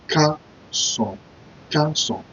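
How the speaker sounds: Ka Son in two clear syllables; Kason split. Shown as a demonstration. Teacher splitting Kason for learners. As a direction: pause between Ka and Son. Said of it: "You pronounce it".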